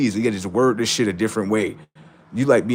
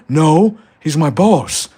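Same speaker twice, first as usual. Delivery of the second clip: mock human answer; 6 semitones higher, 7 dB louder, slower than usual. Human answering the alien boss.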